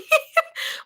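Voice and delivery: very high-pitched squeal of laughter. Real, not acted. Laughing at her own imitation, high squealing giggle.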